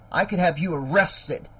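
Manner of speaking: usual speaking voice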